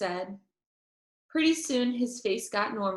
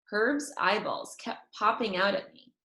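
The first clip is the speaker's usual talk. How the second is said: usual speaking voice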